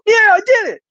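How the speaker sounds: high, loud victory whoop; triumphant yell. Retold or imitated. Comic crowing to himself after making Bert laugh, triumphant yell. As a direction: crow about the win like you nailed it.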